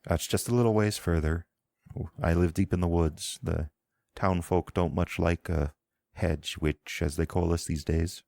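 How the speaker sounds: a Southern Isle healer scolding her patient to lie still; thick Southern Isle accent. Acted. Priestess warning the wounded rider not to sit up, thick Southern Isle accent. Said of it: "in a thick Southern Isle accent".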